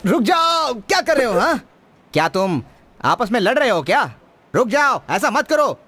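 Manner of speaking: high-pitched, urgent call to stop; scolding shout. Acted. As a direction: call out to break up a fight. Breaking up a fight.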